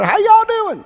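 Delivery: chirpy Southern hello, high up; Southern drawl. Retold or imitated. Mocking how Southern AA members greet, in a high Southern accent. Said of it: "They talk a little like this down there".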